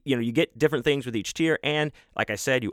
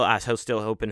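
ordinary talk, nothing marked

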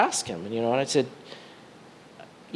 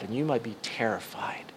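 hushed whisper; 5 semitones lower, 11 dB quieter than usual. Acted. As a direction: drop to a soft whisper. Preacher softens to a whisper.

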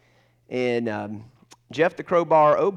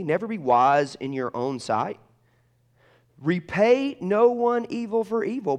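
measured, lifted reading, rising higher near the end; scripture reading; 5 semitones higher than usual. Acted. Preacher reading Romans twelve to the congregation, solemn. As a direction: read it like a solemn exhortation.

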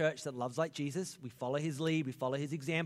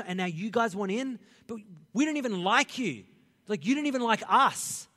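higher, louder indignant rant; indignant Peter; 6 semitones higher, 5 dB louder than usual. Retold or imitated. Peter protesting to the Gentiles, indignant and rising. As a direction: complain like a jealous insider. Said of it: "you got Peter there going".

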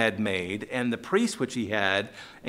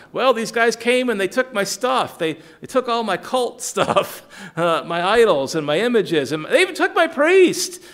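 higher, louder aggrieved complaint; voicing Micah; 8 semitones higher, 5 dB louder than usual. Preacher voicing Micah complaining his idols were taken, high and aggrieved. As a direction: whine it up high and loud, robbed and indignant.

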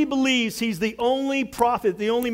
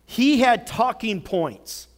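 ordinary talk

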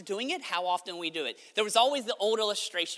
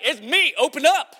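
loud, higher acted call; 6 semitones higher, 11 dB louder than usual. Peter outside the locked door, calling out. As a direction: shout it as if through a door.

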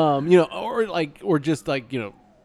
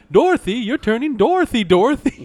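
high, loud exasperated dad; a panicked father shouting a name; Violet's father voice; 9 semitones higher, 8 dB louder than usual. Retold or imitated. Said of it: "he sounded like ... Violet's father".

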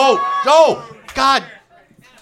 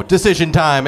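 usual speaking voice

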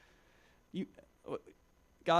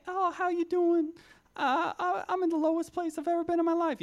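much higher, louder put-on voice; homeless man's voice; 5 dB louder than usual. Youth speaker imitates homeless man's greeting, high put-on voice. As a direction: pitch way up like the homeless guy greeting you. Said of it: "That's actually what he sounded like".

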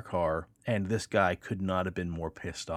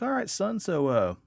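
patrolman; 7 semitones higher, 4 dB louder than usual. Retold or imitated. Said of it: "he's like".

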